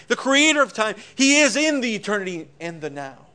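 full-voiced preacher's climax; preaching at full force. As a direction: thunder it from the pulpit. Peak of sermon, preaching.

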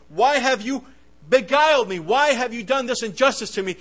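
loud, high wounded accusation; outraged demand. Acted. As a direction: demand an answer, stung and furious. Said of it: "could you imagine Jacob".

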